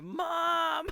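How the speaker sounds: put-on very high, loud kid's yell; imitated child crying out for help; shocked call. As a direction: holler for a parent in mock alarm. Imitates shocked kids calling mother.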